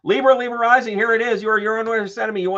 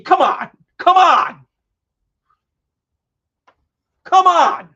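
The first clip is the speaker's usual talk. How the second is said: loud, high, thrilled come on; excited shout; 13 semitones higher, 11 dB louder, slower than usual. Real, not acted. Reader shouting with joy at a great card spread. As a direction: cheer at the cards, full voice.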